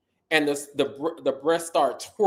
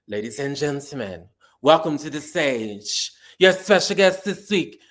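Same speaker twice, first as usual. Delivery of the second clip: drawn-out, louder emcee intro; MC announcement; 4 dB louder, slower than usual. Retold or imitated. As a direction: announce her like a club host. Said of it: "nobody said Jasmine's names like Mina Darnell".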